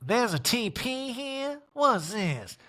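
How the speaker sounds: lightly hammed-up baffled impression; mimicked housewife. Mimicking Erica arriving at house.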